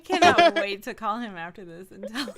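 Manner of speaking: loud, through laughter; laughing. Real, not acted. Can't wait to call dad and tell him, laughing.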